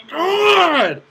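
high, loud cry of frustration; furious yell. Real, not acted. Gamer loses it after a mistake, furious yell. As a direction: yell it at the top of your range.

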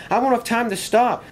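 high-pitched storybook panic; alarmed cry. A narrator's reading. Engine coming over the hill warns another, alarmed. Runaway engine cries warning downhill.

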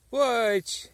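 sudden loud high oops; startled exclamation. Real, not acted. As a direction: yelp as the cap snaps off. Mushroom breaks, exclamation.

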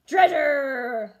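loud shout of treasure; excited pirate cry. A narrator's reading. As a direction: roar it like greedy pirates. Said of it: "the pirates repeated".

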